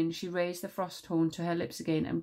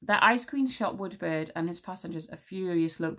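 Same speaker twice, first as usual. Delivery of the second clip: ordinary delivery, nothing marked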